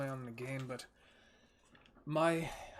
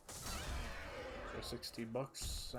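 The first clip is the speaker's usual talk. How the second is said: whispered; 5 dB quieter, much slower than usual. Real, not acted. Awed aside after donation.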